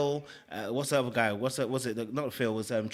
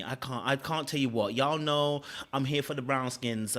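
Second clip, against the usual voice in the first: ordinary talk, nothing marked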